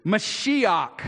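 Mashiach said mah-SHEE-ahk, stress on middle; Hebrew word. Shown as a demonstration.

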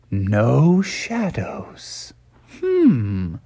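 pondering bear. Acted. Bear looks for shadow.

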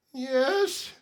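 pretend kid bracing. Pretend band-aid dialogue.

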